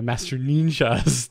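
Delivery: slow, stumbling over the word; botched reading; fumbled name. Real, not acted. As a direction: struggle through the name. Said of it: "that's terrible".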